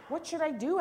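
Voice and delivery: high, loud storybook panic; worried father pleading for advice; alarmed question. A narrator's reading. Alarmed father asks police for help.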